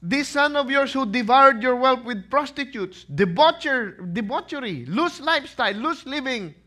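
loud, high, wounded outburst; indignant older brother. Acted. Elder son complaining to his father, loud and hurt. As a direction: pour out the grievance loudly.

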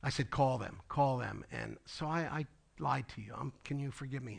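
hushed, sheepish retold apology; low, in-her-ear confession. Pastor confesses a lie to Kyle, hushed. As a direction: confess it softly, close to the ear. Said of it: "I get right in her ear".

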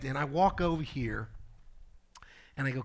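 ordinary talk, nothing marked

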